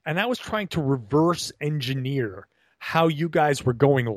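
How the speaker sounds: ordinary talk, nothing marked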